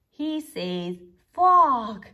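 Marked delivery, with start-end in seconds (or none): 1.3-2.0 a big booming "Fog!" much louder; loud foghorn call. A narrator's reading. Rabbit warning the sea with a loud foghorn cry. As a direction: bellow it like a foghorn. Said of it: "Grumpy Rabbit's voice is foghorn".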